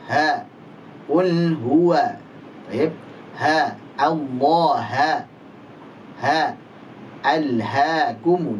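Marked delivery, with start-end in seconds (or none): none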